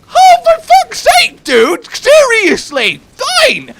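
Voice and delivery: very high, loud exasperated shout; hammed-up fed-up outburst, punching each word; mock exasperation. Customer retelling being doubted about his age, shrieked exasperation.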